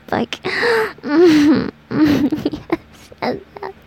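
ordinary delivery

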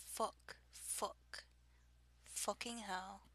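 soft Liverpudlian-tinged British accent; a little Liverpudlian. Shown as a demonstration. Checking how the accent sounded, softly Liverpudlian. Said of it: "little, little Liverpudlian".

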